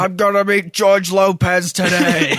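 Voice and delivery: loud, slow, furious fanboy shout; angry nerd voice. Acted. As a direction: bellow it like a cranky superfan. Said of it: "all of my nerd voices are angry".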